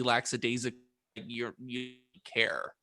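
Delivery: ordinary delivery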